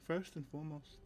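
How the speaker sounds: firm protective bodyguard, understated; Callow speaking plainly. Acted. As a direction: speak plainly, quiet resolve.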